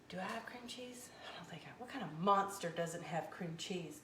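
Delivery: unvoiced whisper to herself. Real, not acted. Cook muttering to viewers in a whisper. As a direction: hush it like a guilty secret. Mock-horror aside about cream cheese.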